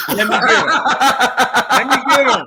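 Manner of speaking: loud, high, playful bark with a laugh; mock tough coach. Coach jokingly calling his player over, loud and high. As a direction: shout it out like a gruff coach grabbing a kid.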